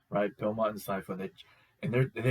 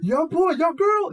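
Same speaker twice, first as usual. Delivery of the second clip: rising, very high loud yells; screaming and hollering; 10 dB louder, slower than usual. Real, not acted. Fan screaming at the fight scene, building high and loud. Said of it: "I was screaming and hollering".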